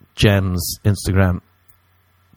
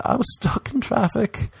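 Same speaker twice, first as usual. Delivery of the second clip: squeaky high excuse; high mock caller voice; 7 semitones higher than usual. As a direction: plead it up high, a bit sheepish. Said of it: "You'll be like".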